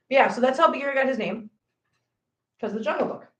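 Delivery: normal talking voice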